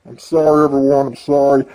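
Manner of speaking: usual speaking voice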